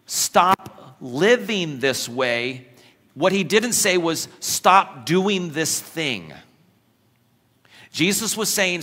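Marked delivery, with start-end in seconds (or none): 0.1-2.7 slow, firm. Acted. Paraphrasing Jesus, very slow. 4.4-6.3 slow, firm. Acted. Paraphrasing Jesus, very slow.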